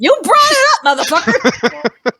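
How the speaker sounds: high, loud mock-outraged comeback; indignant retort. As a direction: fire back in playful outrage. Acted comeback in the quarrel.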